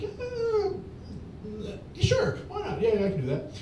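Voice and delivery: high, giddy, laughing yes; eager yes. Retold or imitated. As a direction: jump at the offer, laughing. Eager acceptance, acted quote.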